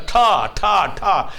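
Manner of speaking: loud, drawn-out bangs for a temper going off; imitated bangs. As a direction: fire off three high, slow booms. Angry outbursts imitated as bangs. (غصے کے دھماکوں کی زوردار نقل)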